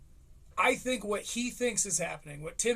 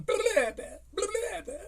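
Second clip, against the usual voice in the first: put-on much higher, childish blah babble; mock kid babble; 14 semitones higher, faster than usual. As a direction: squeak like a bratty kid. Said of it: "the kid who's going".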